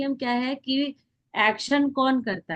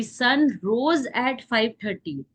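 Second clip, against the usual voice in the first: slow dictation; much slower than usual. Shown as a demonstration. Teacher dictates example sentence.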